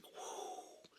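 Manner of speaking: soft voiceless "whoo"; breathy whoosh. Retold or imitated. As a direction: blow out a hushed whoo of escaping air. Imitates wind leaving the prom.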